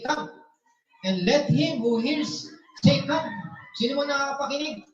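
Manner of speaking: loud, high preaching cry. Acted. Pastor preaching to the church, loud and high. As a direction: preach it out to the congregation. Preaching Revelation verse at full force.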